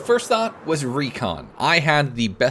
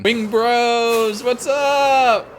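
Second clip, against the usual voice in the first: excited greeting; 11 semitones higher, 6 dB louder, much slower than usual. Real, not acted. Greeting allies loudly.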